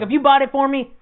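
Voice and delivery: mild retold argument. Arguing with mom, retold.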